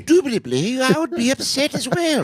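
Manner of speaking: over-polite English agent impression; English accent. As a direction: ham up a prim English operator. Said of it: "like an English accent".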